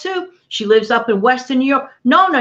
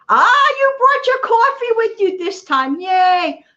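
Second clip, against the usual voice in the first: excited; 11 semitones higher than usual. Real, not acted. Delighted at viewer's coffee.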